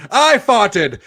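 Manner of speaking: mock heroic claim. Parody of I'm Spartacus.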